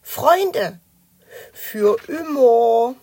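high, very slow creature voice; friendly dragon. A narrator's reading. Dragon pledges friendship to Knötterchen, slow creature voice. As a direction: stretch the vow out in a dragon voice.

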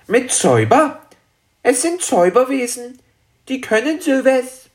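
very high little dragon voice; erklärender kleiner Drache. Acted. Dragon explains the magic. (sehr hohe Drachenstimme, erklärend)

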